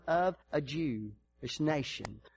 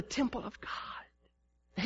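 hushed after the shouting; sudden whisper; 5 semitones higher, 9 dB quieter, much slower than usual. Acted. Pastor telling the congregation, sudden whisper. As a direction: drop to a breath for emphasis.

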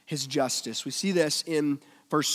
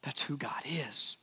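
whisper; 2 semitones lower, 11 dB quieter than usual. Real, not acted. Quiet climax of sermon point.

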